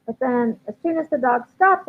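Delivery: ordinary talk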